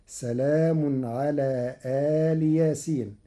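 سلام على آل ياسين with آل long and open, then a kasra ل; slow, keep آل apart from ياسين; aal yaseen split. Shown as a demonstration. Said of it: "بفتح الهمزة ومدها وكسر اللام". (آل مفصولة عن ياسين)

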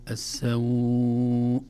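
السوء chanted with a very long glide; longest madd. Shown as a demonstration. Teacher giving the six-count option, السوء fully prolonged. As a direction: stretch السوء out fully and slowly. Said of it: "ست حركات".

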